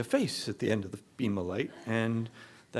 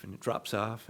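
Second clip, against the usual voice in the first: ordinary talk, nothing marked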